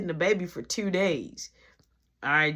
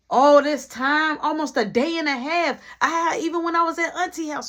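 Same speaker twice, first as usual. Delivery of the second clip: angry complaint; 8 semitones higher, 5 dB louder than usual. Retold or imitated.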